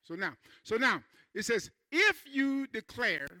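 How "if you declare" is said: slow emphatic reading. Shown as a demonstration. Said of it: "now watch this here".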